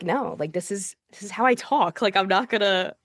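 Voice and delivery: normal talking voice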